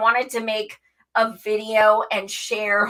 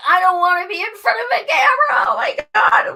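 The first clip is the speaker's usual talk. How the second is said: panicked whine; 11 semitones higher, faster than usual. Retold or imitated. Dreading the camera at first, high panicked wail. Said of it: "I was like".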